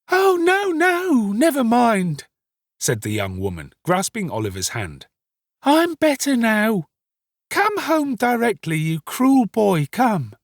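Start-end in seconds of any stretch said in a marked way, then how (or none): 0.1-2.3 very high, loud wailing refusal; distraught young woman. Acted. Hysterical woman waving off help. 5.6-10.3 high, loud, slow pleading; distraught young woman. Acted. Story figure, distraught young woman.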